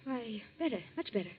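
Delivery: low, very soft reply; faint, subdued. Acted. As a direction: claim it weakly, barely audible. Claims to feel better, clearly shaken.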